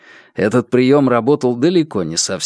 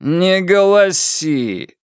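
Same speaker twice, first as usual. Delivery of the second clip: higher, very slow rebuke; annoyed drawl; 7 semitones higher, much slower than usual. A narrator's reading. Brother winces at sister's wailing, slow annoyed drawl. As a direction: drag out the telling-off.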